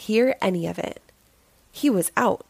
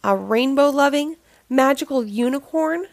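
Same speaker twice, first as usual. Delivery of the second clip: ordinary delivery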